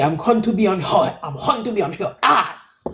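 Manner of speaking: light Haitian accent on the threat; mock Haitian warning, half-playful. Shown as a demonstration. Haitian sister warning about Nick in Haitian accent. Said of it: "with the appropriate Haitian accent".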